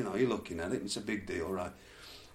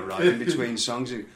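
usual speaking voice

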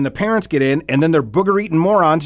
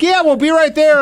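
high, loud cheery phone shout, imitated; loud phone yell; 6 dB louder than usual. New kid tells Todd they're coming, mock loud yelling. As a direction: call it out into the phone, oblivious.

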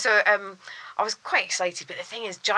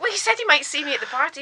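high, quick, eager comeback; retold hopeful protest; 7 semitones higher, faster than usual. Retold hopeful protest to her producer.

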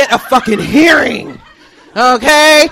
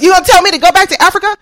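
fast, high, loud outrage; indignant challenge; 5 semitones higher, 4 dB louder, faster than usual. Acted. Student confronting the man, fast indignant challenge. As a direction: fire the question back at him, incredulous.

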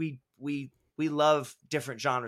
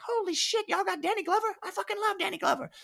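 much higher, gushing imitation of her; imitating her thrilled reaction; pumped-up imitation. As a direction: gush like a fan who just spotted a star. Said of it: "She gets all pumped up".

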